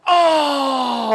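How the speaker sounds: crowd's shocked oh. Retold or imitated. Wrestler retelling the crowd's reaction to the hit, loud shocked oh. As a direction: belt it like a stunned arena crowd.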